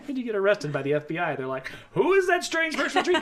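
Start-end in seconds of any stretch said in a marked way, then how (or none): 1.9-3.1 high, loud mock-incredulous question; laughing, pitched-up voice of an agent; mock FBI agent. FBI agent calling up at a treehouse dweller, mock incredulous.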